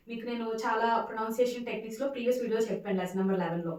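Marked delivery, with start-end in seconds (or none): none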